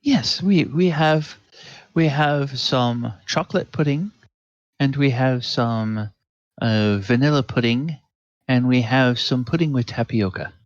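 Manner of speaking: a hint of an eager, fussy waiter; lightly voiced servant listing desserts; eager server. Server eagerly offering puddings to the lady.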